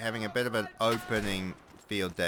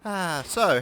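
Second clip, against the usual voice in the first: ordinary delivery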